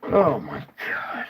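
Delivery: breathless whisper of disbelief; hushed, stunned whisper; awed whisper. Real, not acted. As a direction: whisper it, shaken by what you see. Stunned by the tracker result.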